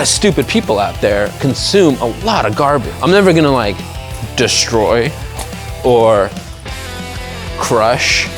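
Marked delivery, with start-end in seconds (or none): none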